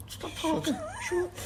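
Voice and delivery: retold hushing of a brother during a live interview; mock hushed warning. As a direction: hush him under your breath.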